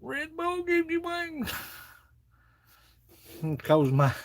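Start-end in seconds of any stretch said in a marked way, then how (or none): 0.0-1.5 "Red Bull gives you wings." in a very high slow falsetto. Pretend. Repeats in falsetto.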